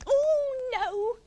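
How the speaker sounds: high, dismayed wail; Iggy crying out. A narrator's reading. Iggy as the itching starts, dismayed cry. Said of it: "cried Iggy".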